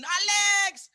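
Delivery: high-pitched yell from the crowd; imitated scream. Uncle screaming to his nephew onstage, acted high yell. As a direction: holler the name to get the performer's attention. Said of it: "I hear someone screaming".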